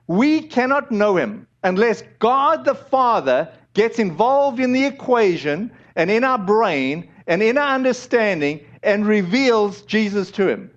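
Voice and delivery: normal talking voice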